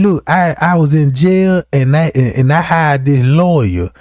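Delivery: normal talking voice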